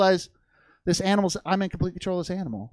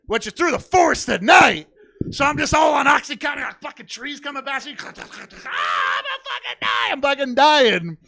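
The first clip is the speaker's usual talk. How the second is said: high, loud screaming terror; hammed-up panicked yelling; 7 semitones higher, 6 dB louder than usual. Drugged rider galloping through forest, mock-terrified screaming. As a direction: yell like you're hurtling through trees.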